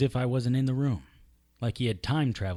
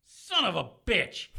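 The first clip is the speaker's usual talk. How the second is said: pained curse; 8 semitones higher, slower than usual. Acted. After leg cut.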